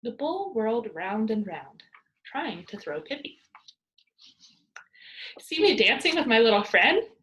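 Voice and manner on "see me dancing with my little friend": loud gleeful call from a bull's back; cried out. A narrator's reading. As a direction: call out loud, full of glee. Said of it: "cried Pippi".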